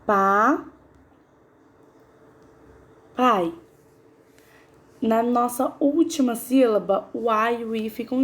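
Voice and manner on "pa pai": "Pa. Pai." said as two separate beats; syllable by syllable. Shown as a demonstration. Teacher separating papai for the class, syllable by syllable. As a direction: break papai into Pa and Pai with a pause. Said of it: "Vamos separar?".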